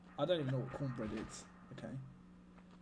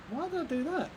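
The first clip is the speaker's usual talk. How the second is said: much higher, louder cry of disbelief; dismayed outburst; 13 semitones higher, 4 dB louder than usual. Real, not acted. Streamer after missing the jump, high dismayed cry. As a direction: yelp it in shock at yourself. Just missed a jump again.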